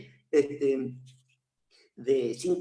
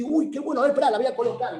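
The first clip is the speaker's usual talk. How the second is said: delighted; 8 semitones higher, 5 dB louder, much faster than usual. Retold or imitated. Therapist voicing delight while playing.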